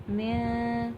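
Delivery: من with a hidden nasal n; nasal ikhfa. Shown as a demonstration. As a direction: hum the n of من into the nose. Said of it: "ناک میں sound جاتی ہے".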